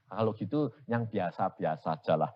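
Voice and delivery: ordinary talk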